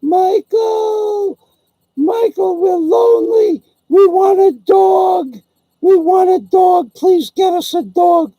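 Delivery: put-on very high, loud, childlike doll voices pleading; dolls crying out. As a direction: wail like lonely little dolls begging for a dog. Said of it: "I hear my dolls crying themselves to sleep".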